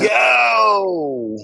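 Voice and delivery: high yelled surfer yo; surfer shout. Acted. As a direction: call out like a stoked surfer. Surfer bit carried on.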